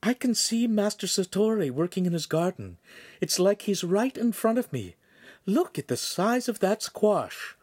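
put-on high girlish voice full of wonder; excited young girl. Voices the lensmaker's daughter.

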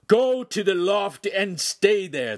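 high, loud, slow command; angry shout. A narrator's reading. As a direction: shout a drawn-out order at the children. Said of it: "the master shouted".

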